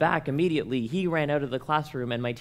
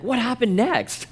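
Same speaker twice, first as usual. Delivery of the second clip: much higher, slow, laughing voice; mock curious listener; 10 semitones higher, 4 dB louder than usual. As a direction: imitate a wide-eyed asker with a giggle.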